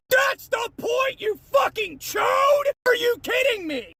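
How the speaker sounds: high, drawn-out yell of outrage; furious shout. Real, not acted. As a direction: yell at a commenter in real exasperation. Furious reply to commenter.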